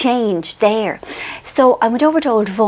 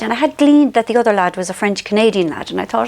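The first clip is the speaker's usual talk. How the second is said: ordinary talk, nothing marked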